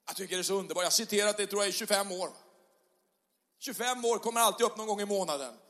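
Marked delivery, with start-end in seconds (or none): none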